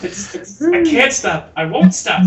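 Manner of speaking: can't stop giggling; real laughter through the words; words broken by real giggles; laughing. Real, not acted.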